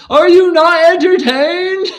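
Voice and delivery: high, loud, slow arena cry; Gladiator quote. Pretend. Quoting Gladiator to viewers, high, loud and slow. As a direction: roar it like a gladiator to the crowd.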